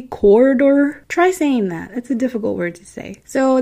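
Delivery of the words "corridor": "Corridor" drawn out slowly, higher, syllable by syllable; careful, questioning pronunciation. Shown as a demonstration. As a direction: test out "Corridor" slowly, unsure it's right. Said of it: "Am I saying that correctly?".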